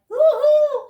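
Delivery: high, loud gleeful whoop; excited whoop. Retold or imitated. Recalling her joy at free bags, excited whoop. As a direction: let out a thrilled woohoo. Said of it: "I was so excited".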